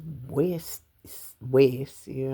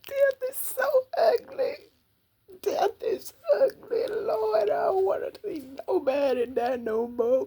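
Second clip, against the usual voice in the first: real sobbing, voice pitched way up; crying; 4 dB louder than usual. Real, not acted. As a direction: cry through the words, high and raw with grief. Crying while recalling a death.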